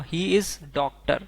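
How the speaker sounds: He is doctor drawn out word by word; very slow. Shown as a demonstration. Teacher reading the English sentence very slowly. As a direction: drag each word out for learners. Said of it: "अगर हमें बोलना हो".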